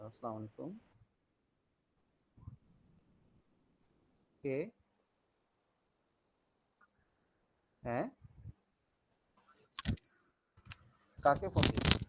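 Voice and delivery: quiet side call away from the mic; talking into a phone, off mic; over the phone. Real, not acted. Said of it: "sorry, একটা call আসছিল".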